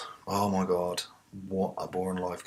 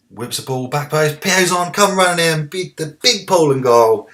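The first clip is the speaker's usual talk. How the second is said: louder and higher play-by-play building to a shout; rising excitement; 8 semitones higher, 12 dB louder than usual. Real, not acted. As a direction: call the attack like a commentator getting carried away. Live commentary on attack.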